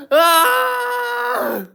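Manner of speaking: high crying mock scream; dramatic scream. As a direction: scream in hammed-up terror. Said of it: "I'm being a little bit dramatic".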